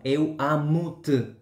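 "Eu amo-te" very slow, European Portuguese accent, "te" almost swallowed. Shown as a demonstration. Teacher contrasting with the Brazilian form, slow European Portuguese accent. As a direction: stretch out "Eu amo-te" like a Lisbon speaker. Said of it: "portugués de Portugal".

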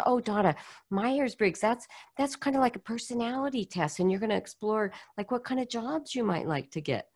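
patiently cheerful program director, a touch condescending; sugary helpful caseworker voice; eager director. Retold or imitated.

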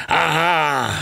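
high, drawn-out aha; exclaiming old general. A narrator's reading. Old general spotting the colonel and two girls, exclaiming. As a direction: cry it out in an old man's put-on voice. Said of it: "kiáltotta".

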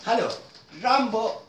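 usual speaking voice

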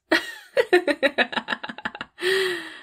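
drawn-out high villainous cackle; long evil cackle. Acted. Boss savoring her new hire's mistake, long evil cackle. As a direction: let the cackle roll on, savoring it. Boss gloats after hiring.

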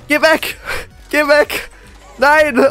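high terrified yells; scared screaming. Real, not acted. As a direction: scream at the killer to leave. Killer attacks him.

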